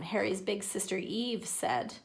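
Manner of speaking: plain reading voice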